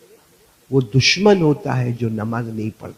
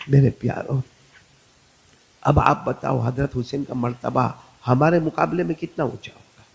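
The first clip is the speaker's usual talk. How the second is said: normal talking voice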